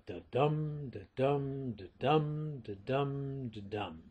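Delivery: da-dum chanted, stress on dum each time; da-dum rhythm. Shown as a demonstration. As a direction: tap out the beat, fading away. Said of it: "the basic underlying rhythm".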